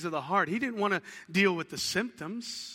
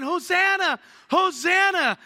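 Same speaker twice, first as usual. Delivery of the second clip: high, loud joyful cry of Hosanna; crowd crying out; 10 semitones higher, 7 dB louder than usual. Retold or imitated. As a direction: call it out like a cheering crowd. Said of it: "everybody's crying".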